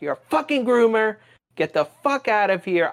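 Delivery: high, loud retold tirade; re-enacted angry shout; going hard. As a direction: tear into them, voice jumping up. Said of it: "I was going hard at him".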